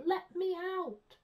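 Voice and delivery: high, drawn-out shout; desperate cry. A narrator's reading. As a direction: cry out slowly from behind a shut door. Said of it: "and a voice cried".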